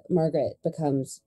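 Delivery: ordinary delivery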